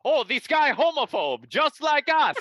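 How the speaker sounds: high, loud cartoon Russian accent; mock Russian accent. Russian fans welcoming a supposed ally, loud mock Russian accent. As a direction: belt it out as excited Russians, accent hammed up. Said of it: "all the Russian fans we gained when they were like".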